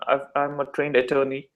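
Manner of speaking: usual speaking voice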